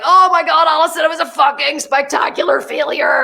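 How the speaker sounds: high, loud exclamation easing off; dramatic dismay. Retold or imitated. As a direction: retell it as an overblown lament. Acting out her dismay over launch.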